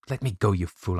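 usual speaking voice

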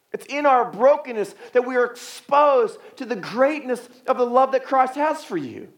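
high, ringing preacher's voice; heartfelt, high-pitched pulpit insistence; impassioned preaching. Acted. Preacher in full voice.

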